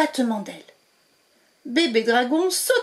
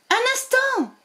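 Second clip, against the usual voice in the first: very high call to wait. Acted. Witch calling to the dragons to wait, high call. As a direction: call them to stop. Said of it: "leur lança".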